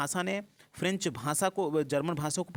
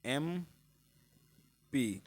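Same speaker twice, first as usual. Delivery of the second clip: slow dictation; 3 dB quieter, much slower than usual. Shown as a demonstration. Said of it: "MP जरा आप पढ़िए".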